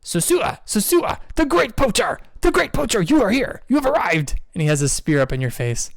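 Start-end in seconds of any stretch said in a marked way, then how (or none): 0.0-4.4 high-pitched penguin chanting a name; penguin creature voice. Acted. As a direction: squeak it out like a small penguin warrior. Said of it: "The penguin turns to you, Veer, and he goes".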